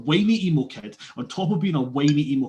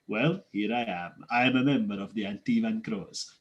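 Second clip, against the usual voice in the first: lower-pitched mock Zevran accent; put-on accent, lower and a little softer; 4 semitones lower, 4 dB quieter than usual. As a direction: imitate a ridiculous foreign accent, pitched down. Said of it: "His accent is ridiculous".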